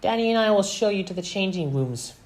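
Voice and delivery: put-on high, quick girl's voice; Velma. A narrator's reading. Daughter showing the team the way, high and quick. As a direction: rattle it off high-pitched. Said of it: "said Velma".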